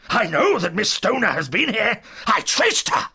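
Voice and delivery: high, loud outburst; furious old bully shouting; angry accusation. Acted. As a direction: fling the accusation at him. Villain accuses.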